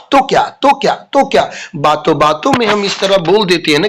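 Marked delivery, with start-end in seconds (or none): none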